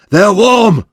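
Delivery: high, loud excited shout. A narrator's reading. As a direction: shout the discovery. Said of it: "he shouted".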